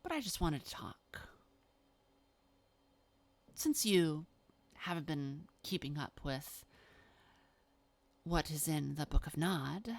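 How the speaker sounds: lightly voiced vampire, coolly persuasive; measured, understated persuasion with pauses; Babs. Malkavian justifies the favour to an angry guest, lightly acted persuasion.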